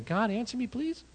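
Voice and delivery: timid plea. Retold or imitated. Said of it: "Elijah, I don't think, was going".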